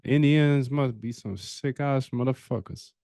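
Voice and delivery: ordinary delivery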